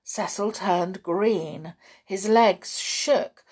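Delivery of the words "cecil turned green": ordinary delivery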